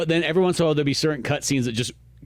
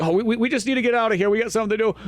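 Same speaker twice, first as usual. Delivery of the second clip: hurried panicky voice; 8 semitones higher, much faster than usual. Retold or imitated. Imitating the fleeing women.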